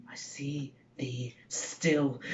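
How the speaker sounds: slow, dread-filled whisper; whispered and drawn out. Acted. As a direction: let it out slowly in a whisper, haunted. Macbeth sees the dagger again.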